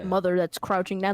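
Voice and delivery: ordinary talk, nothing marked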